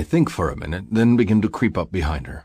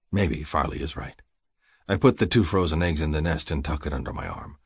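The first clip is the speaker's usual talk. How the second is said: usual speaking voice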